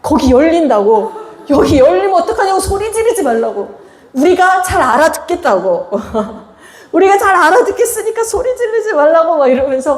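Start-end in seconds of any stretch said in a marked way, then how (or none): 1.5-9.4 high-pitched pleading, laughter creeping in; mock yelled protest. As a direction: imitate students begging the teacher to stop. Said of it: "소리를 막 지르면서 막 그만하래".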